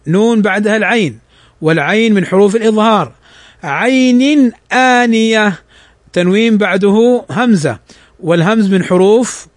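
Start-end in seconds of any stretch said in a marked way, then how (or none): 3.6-5.6 slow chanted tajweed recitation; measured Quranic chant. Shown as a demonstration. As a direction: recite it slowly with tajweed rules.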